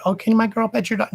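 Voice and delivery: high, sugary mock parent voice; mocking a pushy parent. Mocking a parent who shoves a child at her service dog. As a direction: ape the parent's fake-sweet ask. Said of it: "and say".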